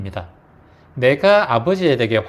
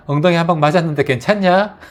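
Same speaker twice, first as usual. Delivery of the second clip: joking tease; 6 semitones higher, 6 dB louder than usual. Shown as a demonstration. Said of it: "농담 삼아 한마디 살짝".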